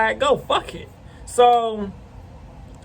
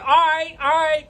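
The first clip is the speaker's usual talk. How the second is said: retold alarmed backing off; 7 semitones higher than usual. Neighbor ducking, expecting shooting.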